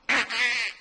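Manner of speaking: short high quack noise; imitated duck call. Nice guy trying to comfort ducks, little quack. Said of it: "So I let out a little".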